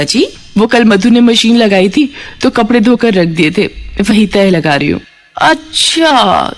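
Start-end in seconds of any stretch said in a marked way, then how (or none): 5.4-6.5 long, slow, drawn-out "achha"; feigned surprise. Acted. Scheming aunt feigning surprise to stir trouble, slow drawn-out. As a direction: pretend to be struck by the news.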